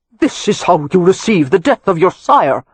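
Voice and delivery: put-on loud, affronted demand in an old earl's voice. A narrator's reading. Old earl to his grandsons on his son's death, demanding. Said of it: "Cavan demanded".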